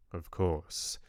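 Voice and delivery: plain reading voice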